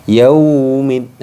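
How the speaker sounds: "Yaumid" with "yau" held too long, loud and high; wrongly held yau. Shown as a demonstration. Teacher showing the wrong overlong "yau", loud. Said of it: "itu terlalu ditahan".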